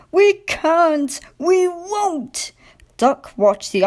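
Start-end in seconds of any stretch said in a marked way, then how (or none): 0.1-2.5 high, stubborn wail; groaning trucks. A narrator's reading. Stubborn trucks refusing Diesel, high stubborn wail. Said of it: "The trucks groaned".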